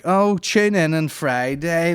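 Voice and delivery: slow, smug teaser; string them along; hammed-up influencer tease; mock hype. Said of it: "There was none of this".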